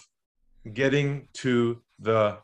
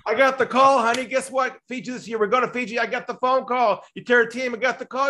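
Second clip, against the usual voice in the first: giddy salesman; 11 semitones higher than usual. Retold or imitated. Mock-excited salesperson on hopium.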